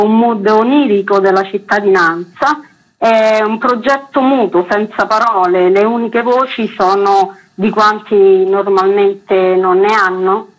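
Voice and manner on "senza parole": usual speaking voice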